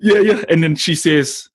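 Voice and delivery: usual speaking voice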